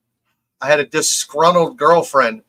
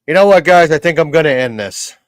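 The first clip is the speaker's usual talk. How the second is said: normal talking voice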